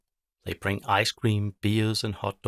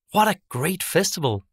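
higher, louder happy exclaim; delighted villagers; 8 semitones higher, 6 dB louder than usual. Acted. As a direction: cheer about the party. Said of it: "they say".

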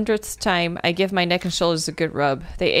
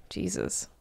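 breathy whisper under breath; 11 dB quieter than usual. Real, not acted. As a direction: say it to yourself in awe. Muttered after chapter ending.

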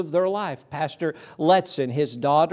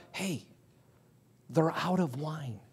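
hushed word; 9 dB quieter than usual. Retold or imitated. Whispered news at the wedding.

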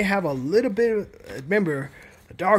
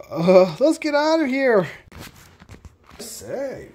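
ordinary talk, nothing marked

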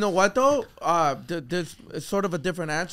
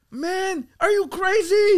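high, drawn-out disbelief; incredulous; 12 semitones higher, 4 dB louder, much slower than usual. Retold or imitated. Telling his crying girlfriend off, high incredulous. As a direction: stretch it out like she's lost it. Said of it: "I'm like".

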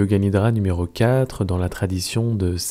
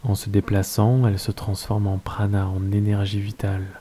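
calm, unhurried Yoga Nidra guidance with long pauses; guided meditation. Acted. Teacher settling listeners into Yoga Nidra, guided meditation voice.

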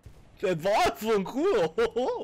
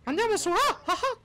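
Mickey Mouse voice; 13 semitones higher than usual. Pretend. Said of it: "Andiamo Topolino".

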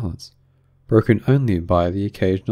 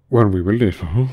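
calmer but sorrowful; 4 dB louder than usual. A narrator's reading. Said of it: "more calmly, though still sobbing a little".

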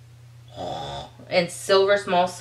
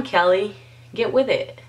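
ordinary talk

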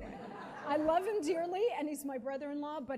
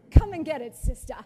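a much louder jeering dare; loud mocking taunt; 5 semitones higher, 16 dB louder than usual. Voicing the dog taunting the guest, loud mockery. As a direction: bellow it like a gloating bully. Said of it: "mocking me".

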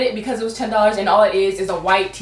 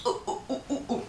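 whispered ooh; 3 semitones higher, 14 dB quieter, faster than usual. Real, not acted. Admiring the shirt.

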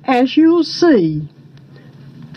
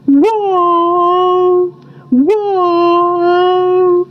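long, held, higher frog drone; frogs' death knell; 5 semitones higher than usual. A narrator's reading. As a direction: sound it like a tolling frog call. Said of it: "the frogs sounded the death knell".

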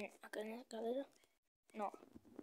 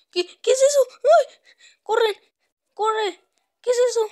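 high, loud terrified shrieks; panicked screaming; 10 semitones higher, 22 dB louder than usual. Real, not acted. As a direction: scream and run for your life. Monster appears, runs away. (gritos de pánico)

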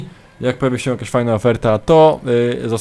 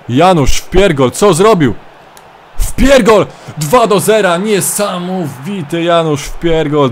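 slow, higher, louder goal call; goal scream; 6 semitones higher, 6 dB louder, slower than usual. Acted. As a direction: roar the second goal like a TV commentator. Second goal called by commentator.

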